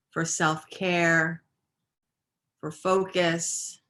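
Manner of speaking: unhurried yoga-teacher meditation voice, phrase by phrase; even, measured breathing-practice guidance with long pauses; guided meditation. Real, not acted. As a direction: guide them gently through the breath, pausing between phrases.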